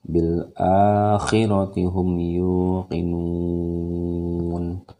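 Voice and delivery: slow melodic Quran chanting; Quran recitation. Shown as a demonstration. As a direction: recite with careful tajwid. Recitation of verses for class.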